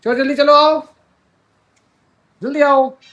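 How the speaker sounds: high, rising come-along call. Real, not acted. Calling child to hurry along. (बच्चे को ऊँचे सुर में बुलाते हुए)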